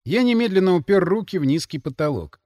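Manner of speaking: ordinary delivery, nothing marked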